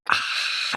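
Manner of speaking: voiceless exasperated "ah"; exasperated breath. Retold or imitated. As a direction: let out a frustrated, soft rush of breath. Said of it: "where you're just like".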